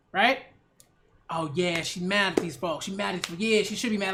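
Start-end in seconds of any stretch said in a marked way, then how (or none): 1.3-4.1 mock compliment. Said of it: "couches his insults in compliments".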